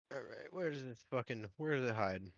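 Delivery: ordinary talk, nothing marked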